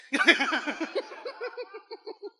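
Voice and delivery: laughing at own bit; high laugh. Real, not acted. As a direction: laugh warmly.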